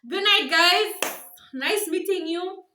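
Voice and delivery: loud, high, slow mock goodbye; hammed-up deathbed farewell; dramatic farewell. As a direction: wave goodbye forever, drawn out and loud. Mock dying farewell before needle.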